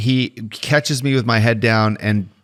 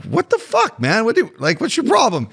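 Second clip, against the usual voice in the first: high-pitched, incredulous protest; mock indignant challenge; 9 semitones higher than usual. Player standing up to the opponent he squirted, mock indignant. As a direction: act out offended innocence, pitch jumping way up. Acted confrontation with angry opponent.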